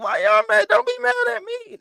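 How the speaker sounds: high, loud, mock-innocent protest; squeaky hammed-up why-me plea; mock baffled innocence. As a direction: play wide-eyed and wronged. Mock baffled at angry callers.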